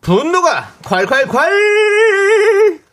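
high, loud, slow stretched shout; shouted title. Acted. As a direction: belt out the segment title. Show segment title shouted. (느리게 늘여 외치는 코너 제목)

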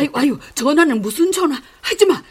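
panicked protest. Acted. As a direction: stop her from calling, alarmed. Mother panics, stops the call. (다급한 만류)

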